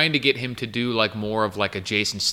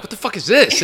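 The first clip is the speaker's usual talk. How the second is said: incredulous outburst; 9 semitones higher, 3 dB louder than usual. Retold or imitated. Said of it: "I'll be like".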